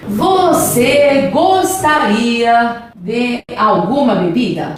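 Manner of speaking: drawn-out, word by word; very slow dictation. Shown as a demonstration. Teacher translates the formal question for students, very slowly. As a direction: stretch each word as if writing it out.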